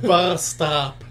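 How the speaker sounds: rolled R on Bus stop; Spanish accent. Retold or imitated. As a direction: Bus stop with a heavy trilled R. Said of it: "They'll be like".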